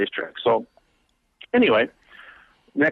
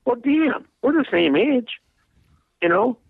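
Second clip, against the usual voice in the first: much higher, astonished aside to yourself; amazed inner thought; 11 semitones higher than usual. Retold or imitated. Watching a same-age driver win, high amazed thought. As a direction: realize it suddenly, pitch jumping up. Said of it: "And I'm thinking".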